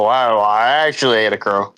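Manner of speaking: ordinary delivery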